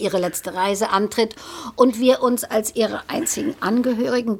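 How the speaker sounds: ordinary talk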